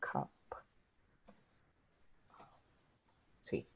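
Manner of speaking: slow, whispery and very soft; drawn-out whisper to oneself; murmured while typing. Shown as a demonstration. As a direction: murmur it slowly, word by word. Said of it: "type in this one".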